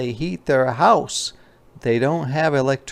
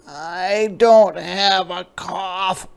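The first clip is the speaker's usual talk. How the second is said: cub voice, much higher, slow and pouty; stubborn little cub; 8 semitones higher, 4 dB louder, slower than usual. Acted. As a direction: insist like a stubborn sick child. Sam denying cough.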